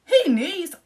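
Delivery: sarcastic outburst. Real, not acted. Mocking the friend's claim.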